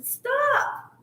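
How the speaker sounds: high squeaky cries of shush, no, stop; playful high-pitched protest; squealing protest. Pretend. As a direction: squeal in mock panic to shut someone up.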